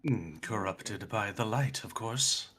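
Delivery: softly voiced cultist; quietly matter-of-fact ominous guide; eerie cultist figure.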